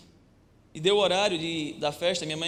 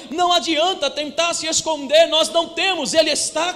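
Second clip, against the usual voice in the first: louder, rising preacher's build; fervent preaching; 9 semitones higher, 8 dB louder than usual. Acted. Pastor preaching to the congregation, louder and fervent. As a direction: preach it out, pushing the congregation along.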